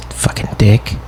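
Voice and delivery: muttered aside. Retold or imitated. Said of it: "Like, you know".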